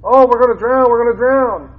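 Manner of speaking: high, loud panicked cry of drowning men; mock panic. Pastor acting out the disciples panicking in the storm, mock terror. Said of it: "they're freaking out".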